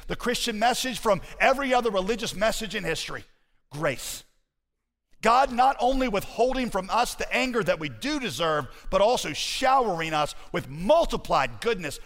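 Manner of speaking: high, driving pulpit delivery; impassioned exposition to a congregation; forceful preaching. Acted. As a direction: preach it emphatically, pitch held up.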